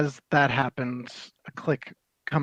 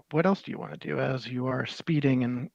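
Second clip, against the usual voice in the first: ordinary talk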